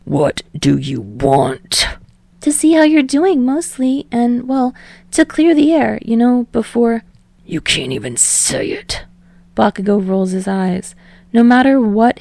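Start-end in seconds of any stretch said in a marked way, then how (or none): 0.0-1.9 snarled. Acted. Bakugo answering Deku's call, low snarl. 2.4-7.0 rambling. Acted. Deku rambling to Bakugo on the phone, higher and eager. 7.5-9.0 lower, softer growling Bakugo voice; snarled. Acted. Bakugo answering Deku's call, low snarl.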